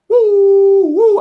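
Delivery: high, loud "woo"; excited whoop. Real, not acted. Sneaker fan showing off a favorite pair, excited whoop.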